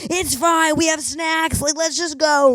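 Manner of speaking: high mock-breezy passengers' voice; a lightly hammed-up crowd of fashion people; mocking fellow passengers. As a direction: brush it off in a squeaky chorus. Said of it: "Everybody's like".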